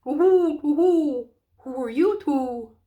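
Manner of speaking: high, hooting owl voice, drawn out; soft hooting owl. Acted. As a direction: hoot it like a sleepy owl. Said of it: "said in a soft, hooting kind of voice".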